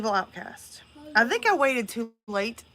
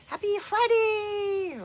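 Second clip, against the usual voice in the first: excited squeal; 12 semitones higher, much slower than usual. Pretend. Dog voice greeting chat.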